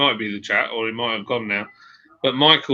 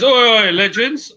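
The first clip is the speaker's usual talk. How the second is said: higher, louder and slow, a hammed-up cheery greeting; hearty lad greeting; 8 semitones higher, 5 dB louder than usual. Streamer reads a viewer's greeting aloud, hammed-up cheery lad. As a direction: boom out a matey hello to the stream.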